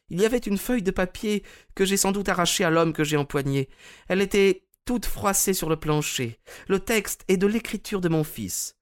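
put-on high, sad old lady voice; a frail lady, smiling sadly; Mrs Maberley. A narrator's reading. Mme Maberley à son conseiller Holmes, voix aiguë et triste.

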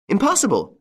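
sudden high outburst of disbelief; blurted out. A narrator's reading. Widow finding the bullets removed, blurting in shock. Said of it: "she blurted out".

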